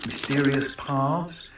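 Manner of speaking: usual speaking voice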